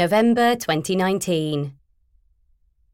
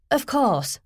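slightly higher, apologetic; flustered reply; 7 semitones higher than usual. Acted. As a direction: blurt it out hastily. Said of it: "I said again".

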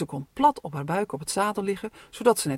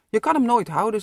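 louder, firm warning; doubting father; 4 semitones higher, 7 dB louder than usual. Acted. Father warns Robin about the kite, loud and firm. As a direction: tell him he can't manage it.

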